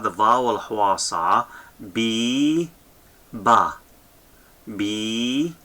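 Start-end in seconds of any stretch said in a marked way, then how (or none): 1.8-5.5 "biba" as long rising "bi", pause, short "ba"; teacher sounding out each syllable. Shown as a demonstration. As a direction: split "biba" with a wide gap. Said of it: "with the syllable biba".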